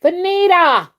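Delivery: high, loud squeaky call of a name; mother yelling for her child; squeaky mom calling. Retold or imitated. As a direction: call the kid like a shrill mother. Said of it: "my mom had a squeaky voice".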